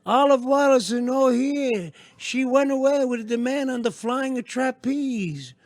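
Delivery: high, slow, hammed-up Italian mother; singsong Italian-accented cartoon mama, pitched high. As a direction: draw it out in a put-on Italian accent. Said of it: "says in an Italian accent".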